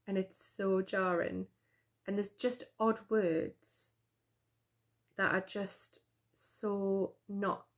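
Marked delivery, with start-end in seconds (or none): none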